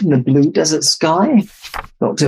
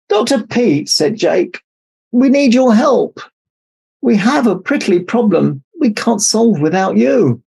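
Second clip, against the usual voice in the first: pleading snake; 7 semitones higher than usual. A narrator's reading. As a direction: beg the doctor for help. Animals plead for help.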